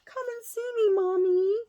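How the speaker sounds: very high little pleading voice; birds' voice. Retold or imitated. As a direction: beg like a tiny bird wanting Mummy. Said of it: "and they're like".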